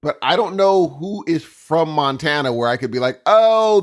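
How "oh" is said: mock realisation. Imagined recognition of the accent.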